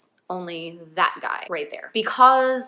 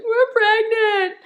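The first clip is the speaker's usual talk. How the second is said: high, loud, drawn-out wail; hammed-up tearful squeal; mock hysterical crying; 7 dB louder, slower than usual. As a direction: wail it slowly through exaggerated sobs. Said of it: "like, hysterically crying".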